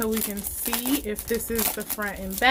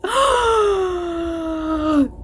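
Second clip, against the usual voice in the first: gasp; 7 semitones higher than usual. Real, not acted. Seeing the mug's design.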